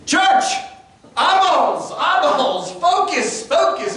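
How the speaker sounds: a preacher yelling for focus; impression of Matt Miles' shout. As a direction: bark them to grab the room. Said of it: "he had a way of getting your attention".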